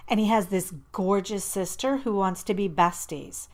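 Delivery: usual speaking voice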